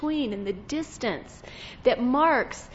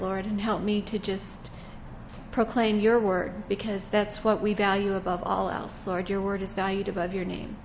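praying; 4 semitones lower than usual. Acted. Closing prayer.

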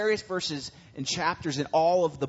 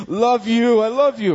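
much louder, higher cooing; smothering love; 7 semitones higher, 11 dB louder than usual. Retold or imitated. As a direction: overdo the affection, squeezing the cat. Hugging squirming cat.

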